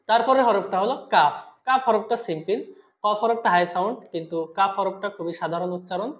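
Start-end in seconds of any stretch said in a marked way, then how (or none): none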